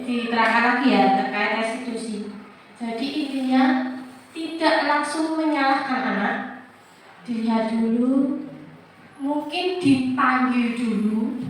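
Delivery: ordinary talk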